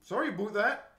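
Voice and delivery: Canadian rounded "Sorry aboot that"; Canadian accent. Shown as a demonstration. Showing friend an apology, mock Canadian accent. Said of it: "just Canadian then".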